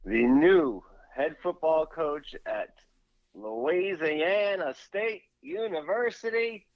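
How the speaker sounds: imitating Brian Kelly's new Southern accent, slow and high; mock Southern accent. Co-host mocks the LSU coach's new twang in a slow Southern accent. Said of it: "a Southern accent to him".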